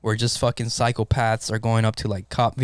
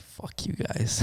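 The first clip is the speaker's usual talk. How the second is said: whispered, much softer curse; muttered curse; 9 dB quieter, much slower than usual. Retold or imitated. Paramedic cursing out the bosses who demand a report, whispered. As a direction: hiss it under your breath.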